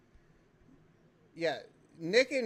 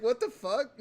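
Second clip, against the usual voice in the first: retold outburst; 5 semitones higher, 7 dB louder than usual. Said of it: "and I'm like".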